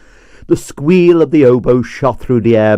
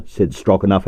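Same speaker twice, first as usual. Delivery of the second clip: usual speaking voice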